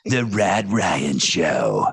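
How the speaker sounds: low, very drawn-out announcer; imitating a deep station voice-over; big radio voice. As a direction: drop it down and stretch every word. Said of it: "Do the voice".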